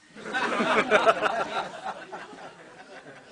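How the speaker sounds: loud burst of laughter at his own punchline; hearty laugh. Real, not acted. Speaker laughing loudly at his own dog joke. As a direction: laugh out loud at the joke you just told.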